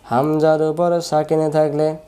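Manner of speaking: slow, measured sing-song drill; rule recited for students. Shown as a demonstration. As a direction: chant the rule with drawn-out vowels. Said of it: "বলেন". (সুর করে নিয়ম মুখস্থ করানো)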